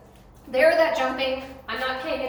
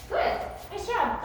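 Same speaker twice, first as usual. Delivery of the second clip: praise voice; 9 semitones higher than usual. Real, not acted. Praising dog after good choice.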